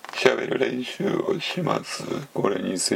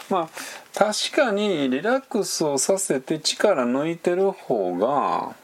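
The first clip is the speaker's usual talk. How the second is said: ordinary delivery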